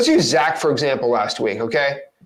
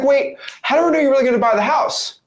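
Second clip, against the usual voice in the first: mock suspicious seller, higher and quicker; 9 semitones higher, faster than usual. Doubting seller questioning the buyer, mock suspicious and higher. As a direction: play the doubting seller, fast and high. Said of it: "She might be like".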